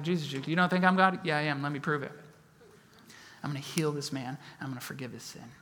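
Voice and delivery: quietly confident, no need to shout; understated calm authority; quiet Jesus voice. Retold or imitated. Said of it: "he does a Jesus juke".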